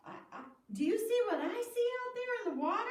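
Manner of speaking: high, fast and amazed; excited disbelief. Acted. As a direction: blurt it out, stunned at what he sees. Andrew sees Jesus on water.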